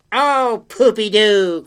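high, loud scoffing creature; pooh-pooh it loudly; dismissive cry. Acted. Creature scoffs at girl's worry, loud and high. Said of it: "cried the fly-by-night".

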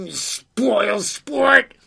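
high, loud creature jeer; yell. Acted. Creature jeers at frightened girl, loud high yell. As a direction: yell it as a taunt. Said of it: "yelled the fly-by-night".

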